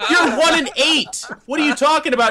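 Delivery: high, incredulous retort; hammed-up squabbling with a kid; acted indignant comeback. Player firing back at child over scores, high indignation.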